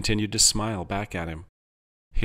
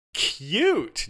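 high-pitched gush of delight; syrupy, high squeal of approval; 11 semitones higher than usual. A narrator's reading. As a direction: gush as if over something adorable. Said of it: "Jarvis gushed".

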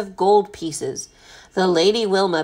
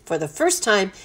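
ordinary delivery, nothing marked

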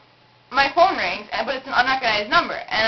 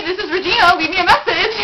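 a hint of a chirpy, higher recorded greeting; voicemail greeting; 7 semitones higher, 4 dB louder than usual. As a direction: lightly mimic your own answering-machine message. Imitating her own voicemail greeting.

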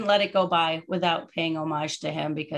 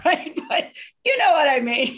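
high, louder laughing; 11 semitones higher, 6 dB louder than usual. Real, not acted. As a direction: giggle through the words. Embarrassed laughing remark.

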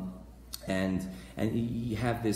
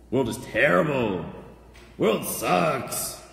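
loud mock lament with falling endings; mock complaining; 5 dB louder, slower than usual. Preacher mimicking people complaining about the world, mock complaining. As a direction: complain loudly, hammed-up.

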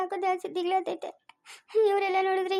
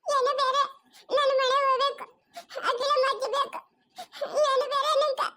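put-on much higher, tearful little girl's pleading voice; a small girl begging to be taken to grandma; high, whimpering child voice; lost little girl; 10 semitones higher than usual.

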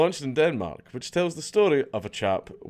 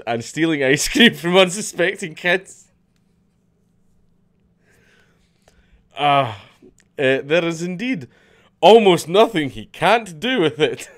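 reading through giggles, pitched up; can't hold back the laughter; cracking up mid-line; 5 semitones higher, 6 dB louder than usual. Real, not acted. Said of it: "why is this so funny".

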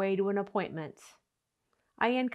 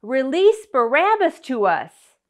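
loud crowd demand, much louder than the narration; crying out; 3 semitones higher, 9 dB louder than usual. Acted. Crowd demanding Pilate release Barabbas, crying out. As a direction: cry out as one angry crowd. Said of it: "with one voice they cried out".

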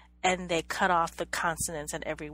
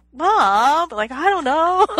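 high, loud mock whine to mom; mock protest; 9 semitones higher, 13 dB louder, slower than usual. Madeline protesting to mom, high loud mock exclamation. Acted quote of exasperated Madeline.

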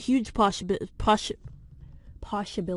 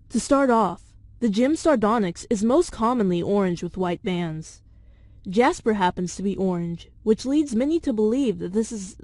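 script reading; 5 dB louder than usual. Acted. Reads theory script aloud.

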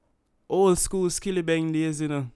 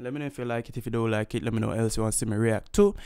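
usual speaking voice

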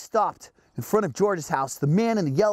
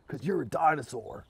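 plain reading voice

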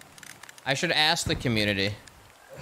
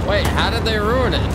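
loud call; 5 semitones higher, 7 dB louder than usual. Real, not acted. Calling out in game.

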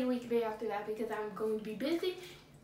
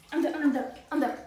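loud desperate refusal; loud protest; 2 semitones lower, 5 dB louder, faster than usual. Real, not acted. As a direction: insist loudly you quit. Quitting the freezing bath.

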